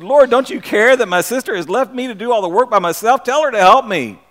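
mildly huffy plea to Jesus; Martha complaining. Acted. Martha complaining to Jesus about her sister, mildly indignant. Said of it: "she came to Jesus and she said".

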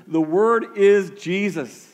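slow, emphatic "The Word is Jesus"; emphatic declaration. Acted. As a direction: proclaim it as the big reveal. Sermon climax, key claim.

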